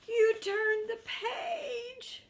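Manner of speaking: high, loud, slow aghast cry with a laugh; shocked Grover. Acted. Grover scolds the reader for turning, high shocked cry. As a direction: cry out in dismay.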